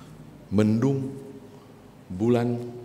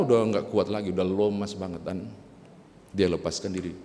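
usual speaking voice

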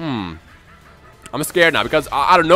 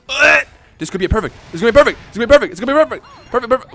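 higher and louder, excited chant; excited building hope; 9 semitones higher, 8 dB louder, faster than usual. Real, not acted. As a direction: cheer yourself toward a perfect round. Chasing a perfect round.

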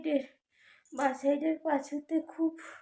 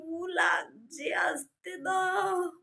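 crying in pain; 5 semitones higher, 5 dB louder, much slower than usual. Retold or imitated. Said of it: "আমি বলছি".

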